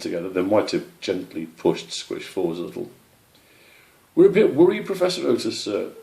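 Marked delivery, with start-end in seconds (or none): 4.2-5.9 high, louder worried opening; anxious young squirrel. Acted. Squish tells the owl they are worried, high. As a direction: nervously address the professor.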